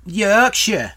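mock Yorkshire accent, put on for a demo. Shown as a demonstration. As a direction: announce it in a broad Yorkshire accent. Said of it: "Now do the accent".

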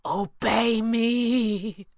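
high, drawn-out plea; implore, stretching each word; imploring. A narrator's reading. Villain begging his horde one last time, imploring. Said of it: "Pavli implored one last time".

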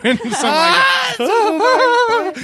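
laughing. Retold or imitated. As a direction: crack up while telling it. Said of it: "laughing retold exchange".

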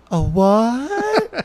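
high, odd, drawn-out "A what?"; weird fisherman voice. Retold or imitated. Imitating the fisherman's strange "A what?" to the podcast friends. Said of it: "the way he says it is so fucking weird".

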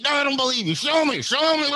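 loud, high, frantic demand; hammed-up taunting yell; mock goading. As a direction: egg someone on in an exaggerated provoking voice. Imitating date goading him to rage.

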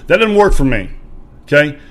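loud, high-pitched burst of anger; fed-up yell at a friend who's quitting; retold angry outburst. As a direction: explode at your friend. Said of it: "I'm hitting my steering wheel".

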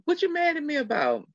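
high-pitched puzzled comeback, falling at the end; imitating a puzzled coworker; retold puzzled question. Retold puzzled reply to her.

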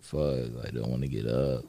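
low, soft half-asleep grumble; groggy grumble. Retold or imitated. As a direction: mumble it like you just woke up at night. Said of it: "And I'm like".

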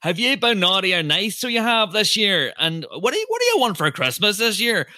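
high, thick Northern Irish Santa; Northern Irish accent. Retold or imitated. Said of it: "a massively thick Northern Irish accent".

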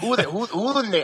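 mock-indignant mama asking who that is; his mom. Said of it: "She goes".

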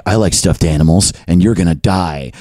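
low, deadpan online threat; mock threat. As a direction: drop the pitch for a mock menacing troll. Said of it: "someone with no face or real name".

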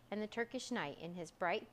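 ordinary delivery, nothing marked

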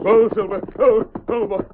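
calling to horse. Acted. Masked man arriving at sheriff's office, calling Silver. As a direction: bring the horse to a halt.

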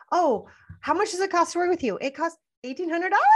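high, unsure upturn at the end of every sentence; rising mom voice. Shown as a demonstration. Coach mocking a timid seller, high questioning pitch. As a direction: make the price sound like a nervous question. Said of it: "turn into a mom and end the sentence like this".

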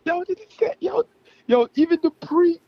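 giddy hype. Real, not acted. Fan gushing to cohosts about favorite verses, squealing excitedly. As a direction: gush like a thrilled fan. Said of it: "I was so fucking hyped".

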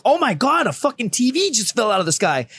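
high, hammed-up disbelief; mock astonishment. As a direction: exclaim it like a pretend shocked onlooker. Mock reaction the duty never had.

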